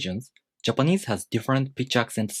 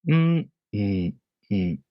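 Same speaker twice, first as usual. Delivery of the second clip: hummed head-high pattern; slower than usual. Shown as a demonstration. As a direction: hum one high note, then drop for two. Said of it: "The pattern for this word is head high".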